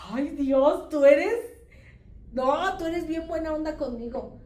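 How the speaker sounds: high, loud delighted marveling; grateful amazement. Retold or imitated. Retelling her thanks to God for the atole, delighted amazement. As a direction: marvel at God in hammed-up gratitude.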